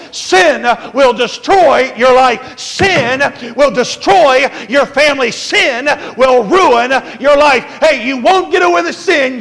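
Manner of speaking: loud, pounding sermon warning; a thundering preacher's warning to young people; preaching at full force. Acted. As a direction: hammer every line at the congregation.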